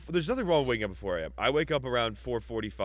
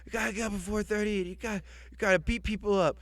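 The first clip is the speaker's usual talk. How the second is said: higher, pumped-up, out-of-breath pep talk; Mark Wahlberg impression; 7 semitones higher than usual. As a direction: hype up the guys like a breathless action star. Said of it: "he's always out of breath".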